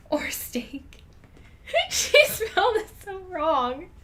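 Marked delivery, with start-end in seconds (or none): 1.7-3.9 giggling through the words; squeaky laugh, slowed by giggles; can barely get it out for laughing. Real, not acted. Laughing at typos while reading.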